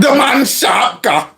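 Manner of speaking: high, slow, exaggerated Somali mimicry; mock Somali accent. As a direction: parrot the Somali accent, drawn out. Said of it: "He's really nailed that accent".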